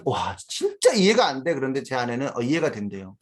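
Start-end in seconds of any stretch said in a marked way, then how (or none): 0.0-1.5 put-on a father baffled by one of his kids; exasperated disbelief. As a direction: throw up your hands in disbelief. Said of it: "저는".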